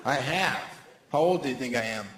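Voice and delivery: usual speaking voice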